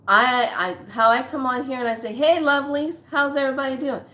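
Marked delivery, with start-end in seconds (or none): 2.1-4.0 bright, raised sing-song hello; a louder, higher singsong welcome; cheery greeting. Retold or imitated. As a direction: greet everyone with big cheer. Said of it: "I was like that throughout".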